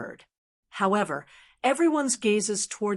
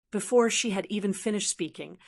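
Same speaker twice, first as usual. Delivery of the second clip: ordinary delivery, nothing marked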